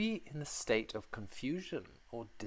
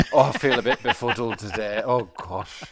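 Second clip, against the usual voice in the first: mock drunk; 11 dB louder than usual. Playing drunk after joke.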